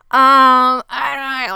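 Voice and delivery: high, whiny mock hesitation; nervous stalling. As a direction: open with a loud, drawn-out um. Husband nervously confessing in retelling.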